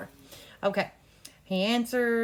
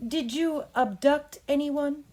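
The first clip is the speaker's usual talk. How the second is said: slow and higher; slow question; 7 semitones higher, slower than usual. Shown as a demonstration. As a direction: ask it slowly and clearly. Coach demos lawyer's question.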